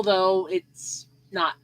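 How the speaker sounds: usual speaking voice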